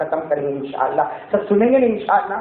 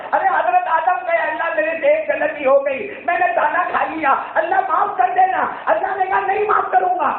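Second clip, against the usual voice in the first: impassioned plea; 10 semitones higher than usual. Acted. Adam pleading, God refusing.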